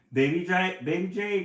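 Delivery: high, loud anxious call; mother bird calling loudly for her chick; crying out. A narrator's reading. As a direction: call out for her lost baby. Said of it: "cries Mama J".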